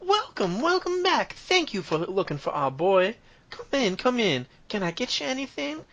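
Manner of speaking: very high-pitched welcoming wife voice; smiling hostess. Acted. Wife welcoming those who found her boy, high and smiling. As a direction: greet them in a high, smiling woman's voice. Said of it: "but she's kinda smiling".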